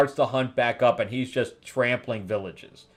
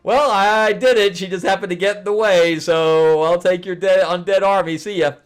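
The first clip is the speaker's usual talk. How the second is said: much higher, louder, quick gloating voice; smug sorcerer; 8 semitones higher, 8 dB louder than usual. Retold or imitated. Kulan Gath gloating to the demon as he leaves. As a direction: gloat and brush them off. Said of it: "And he's like".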